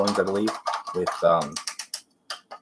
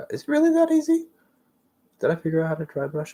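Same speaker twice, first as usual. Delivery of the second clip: high, fast astonished question; can't believe how easy it is; amazed disbelief; 10 semitones higher, much faster than usual. Real, not acted. Surprised at own dry brushing.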